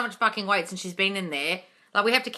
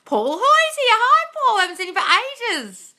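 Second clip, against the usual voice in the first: much higher and louder, squealing hello; delighted welcome; 8 dB louder, slower than usual. Real, not acted. Streamer welcomes longtime viewer Paul, thrilled squeal.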